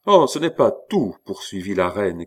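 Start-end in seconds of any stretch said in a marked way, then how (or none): 0.0-1.2 higher, heated exclamation; growing animation. A narrator's reading. Queen presses on against the cardinal, getting animated. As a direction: launch in, getting worked up. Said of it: "qui s'animait peu à peu".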